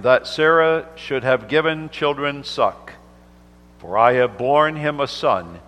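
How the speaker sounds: measured, solemn Bible reading; unhurried King James reading; scripture reading. Acted. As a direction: read the holy word from the pulpit. Minister reads scripture aloud.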